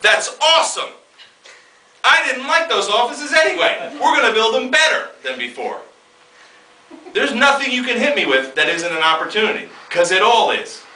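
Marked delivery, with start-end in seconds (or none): 0.0-8.7 1 mock delighted. Upbeat reaction to disaster.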